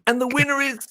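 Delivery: high, suspenseful mock announcement; show host announcing a winner. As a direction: imitate a host holding back the result. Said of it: "I'd be like".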